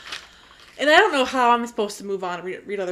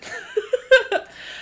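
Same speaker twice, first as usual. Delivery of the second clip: giggle; 13 semitones higher than usual. Real, not acted. Laughs at own review note.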